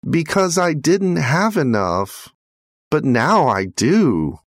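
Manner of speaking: lightly voiced high child, earnest; little boy. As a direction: explain simply like a small boy. Boy explains to father.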